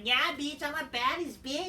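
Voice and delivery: mocking Shayna. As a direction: strut it like a smug tough girl. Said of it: "And I'm like".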